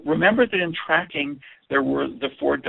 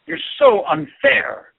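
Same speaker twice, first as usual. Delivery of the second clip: much higher, loud, slow outburst; high loud slow protest; loud angry complaint; 11 semitones higher, 7 dB louder, slower than usual. Shown as a demonstration. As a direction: wail it out, drawn and loud.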